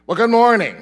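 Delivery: cheery loud greeting to the congregation; big greeting. Real, not acted. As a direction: call it out to the whole room.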